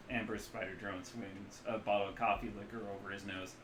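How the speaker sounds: normal talking voice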